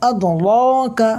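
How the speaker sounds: slow, drawn-out chanted recitation; Quran recitation. Shown as a demonstration. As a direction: intone it slowly like tajweed recitation.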